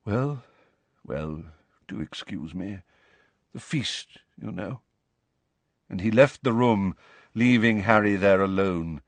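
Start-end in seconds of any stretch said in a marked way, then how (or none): 0.0-4.8 soft, halting excuse; gentle. A narrator's reading. Nick takes his leave for the feast, gently. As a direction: excuse yourself quietly, with small pauses. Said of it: "said Nick gently".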